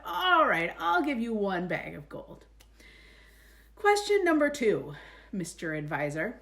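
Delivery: loud annoyed king; king's frustrated bark. Acted. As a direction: grant it through your teeth, loudly.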